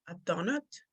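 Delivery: "donut" with a short Spanish-style O, quietly; accented donut. Retold or imitated. Speaker telling her friend what she'll buy, accented short-O donut. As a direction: say "donut" softly with a short, pure O. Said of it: "me and my accent".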